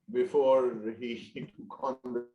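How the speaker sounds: choppy connection dropouts; breaking up over a bad line; voice coming and going. Real, not acted. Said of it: "your voice is coming and going".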